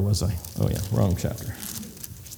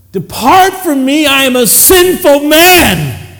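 loud, higher, slow pleading; anguished cry; 11 semitones higher, 9 dB louder, slower than usual. Acted. Kneeling Peter begs Jesus to depart, crying out. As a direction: cry it out in shame.

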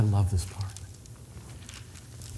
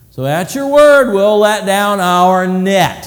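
slow, loud, higher condescension; patronizing; 4 semitones higher, 6 dB louder, slower than usual. Acted. Peter humors Jesus before the crowd, slow and loud. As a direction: humor him, drawn out.

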